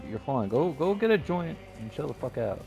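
ordinary talk, nothing marked

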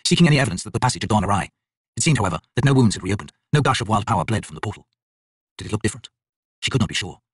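plain reading voice

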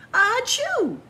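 loud high sneeze; big sudden achoo. Acted. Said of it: "awoke with a sneeze".

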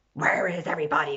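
quick whispered growl; pirate captain growl. Acted. Pirate captain growling in the empty square. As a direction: growl it under your breath. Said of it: "growled the pirate captain".

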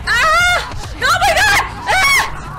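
real high shrieks of panic, loud and piercing; terrified screams. Real, not acted. Player chased by zombies in the game, terrified shrieking. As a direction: scream like the dead are right behind you.

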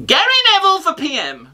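high, sustained campaign call in character; mock rallying cry. Comic backing a rival pundit, mock rallying cry.

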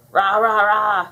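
loud, chanted rahs; monster language. A narrator's reading. Bubbles talking to the monster, roaring in its language. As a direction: talk in short roars. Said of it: "Bubbles said to the monster".

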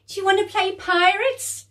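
put-on high, loud, playful child voice; a little sister begging to play; eager little girl. Jade voiced by reader.

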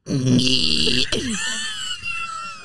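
long, high imitated squeal; mock high whine trailing away; high-pitched squeal. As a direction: squeal thinly and hold the note. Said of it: "And he's like".

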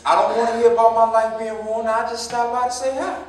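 loud, wounded protest at his father; angry outburst. Acted. Son raising his voice at his father, angry.

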